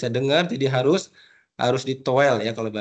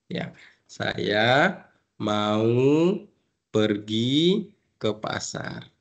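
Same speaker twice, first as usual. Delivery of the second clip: very slow, each word mouthed apart; slow and clear for a deaf friend; slow clear lip speech; 2 semitones lower, much slower than usual. Shown as a demonstration. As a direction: speak slowly so lips can be read.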